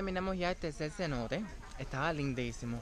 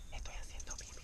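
hushed confession; whispering; 10 dB quieter than usual. Real, not acted. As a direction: whisper it so nobody hears. Confessing quietly in the water.

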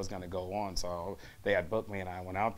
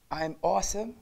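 higher-pitched attempt at an English accent; 9 semitones higher, 3 dB louder than usual. Shown as a demonstration. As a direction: try it in a not-quite-perfect English accent. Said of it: "Can you do that in an English accent".

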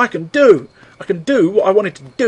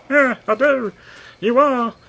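put-on cheeky kid; 7 semitones higher than usual. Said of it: "calling a car is like".

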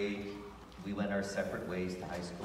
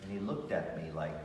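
plain reading voice

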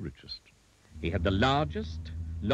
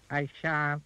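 higher-pitched goblin refusal; 6 semitones higher than usual. A narrator's reading. Goblin defies shrunken Mister Uppity, high grinning goblin.

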